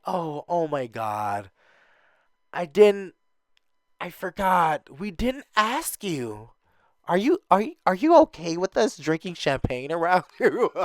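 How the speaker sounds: mock ditzy voice with a laugh; fake dingy girl. Mimicking a ditzy newbie asking about champagne. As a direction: play up the airhead act. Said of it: "the fake dingy girls".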